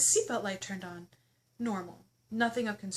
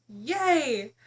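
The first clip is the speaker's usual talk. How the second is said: mock cheer; 7 dB louder than usual. Real, not acted. Mock cheer at free wine.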